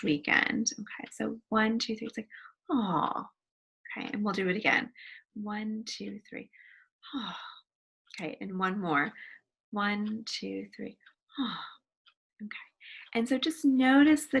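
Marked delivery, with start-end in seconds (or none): 2.7-3.3 tender aw at a cute puppy; sigh of awe. Shown as a demonstration. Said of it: "sigh of awe". 7.1-7.4 sigh of awe. Shown as a demonstration. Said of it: "sigh of awe". 11.4-11.6 sigh of awe. Shown as a demonstration. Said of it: "sigh of awe".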